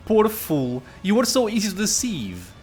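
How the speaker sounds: lightly acted taunt; mock pity. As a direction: deliver it theatrically, as a game villain's line. Dialogue option read out in character.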